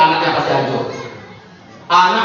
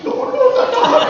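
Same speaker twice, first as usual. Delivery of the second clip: mock panicked squeal; much slower than usual.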